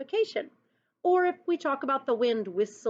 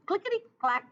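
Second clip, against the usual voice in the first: much higher clickety clack; squeaky clickety; 9 semitones higher, faster than usual. Acted. Teacher voicing the typewriter with a high clickety. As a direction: pipe it up like a typewriter.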